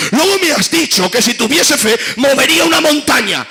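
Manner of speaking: high, loud, impassioned sermon; loud fiery preaching, half shouted; preaching at full force. Acted. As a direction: thunder it from the pulpit.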